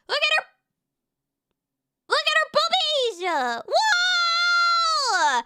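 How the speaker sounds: high, drawn-out excited squeal; over-the-top hype. Pretend. Streamer showing chat a friend's thumbnail, over-the-top hype. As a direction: crow it out high, stretching the last word.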